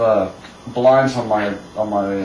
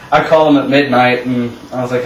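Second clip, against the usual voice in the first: normal talking voice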